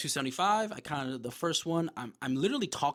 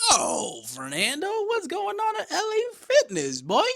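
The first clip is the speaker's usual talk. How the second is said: loud, much higher, slow playful call; hammed-up greeting; 10 dB louder, slower than usual. Playfully hailing Fernando at L A Fitness, loud and high. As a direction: call out his own name like a hype buddy. Mock greeting to himself in gym.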